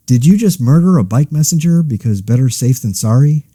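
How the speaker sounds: ordinary talk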